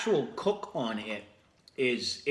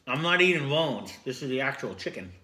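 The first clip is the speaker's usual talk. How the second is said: usual speaking voice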